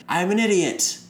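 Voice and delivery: high shout of I'm an idiot, falling; yelling into the abyss. Retold or imitated. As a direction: yell it out into the void. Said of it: "he just yells into the abyss".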